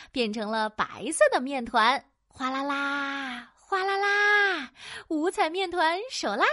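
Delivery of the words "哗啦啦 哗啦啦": slow, held splashing noise; drawn-out water sound. Acted. Storyteller voicing flour jumping into water, drawn-out splash.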